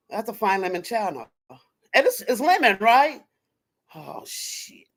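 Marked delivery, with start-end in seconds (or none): 3.9-4.9 very soft aside under the breath; hushed, whispered oops; whispered curse. Real, not acted. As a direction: swear to yourself, almost soundless. Muttered aside while searching for channel.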